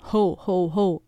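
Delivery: lightly gruff Father Christmas; a hint of rough Santa laugh-call. A narrator's reading. Father dressed up as Santa at the door, rough-voiced. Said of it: "sagt mit rauer Stimme".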